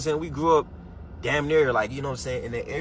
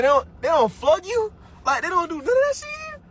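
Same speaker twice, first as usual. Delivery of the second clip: amazed disbelief; 4 dB louder than usual. Real, not acted. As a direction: sound floored that nobody gets punished. Amazed at lax US schools.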